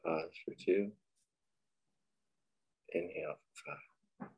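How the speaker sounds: unhurried, quiet cues for breath and body; guided meditation. Acted. Leading listeners through breathing and a body scan, guided meditation.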